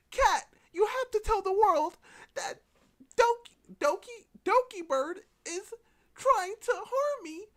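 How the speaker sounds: loud, very high mock wail, drawn out; hammed-up victim crying out for help; melodramatic plea. Artist begging Cat to tell the world, loud high mock plea.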